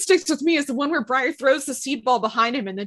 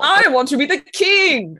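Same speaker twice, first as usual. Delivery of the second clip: villain voice; 4 semitones higher, 6 dB louder, slower than usual. Retold or imitated. Playing the villain, joining in.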